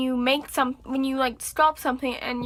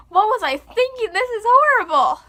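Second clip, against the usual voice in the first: high, loud mock dismay; hammed-up shock at old art; mock horror; 9 semitones higher, 7 dB louder than usual.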